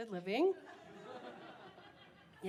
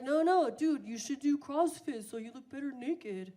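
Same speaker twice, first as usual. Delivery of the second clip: bro voice; 3 semitones higher, 5 dB louder than usual. Retold or imitated. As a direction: play an eager fitness bro. Imitating the CrossFit guy.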